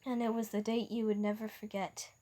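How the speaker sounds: usual speaking voice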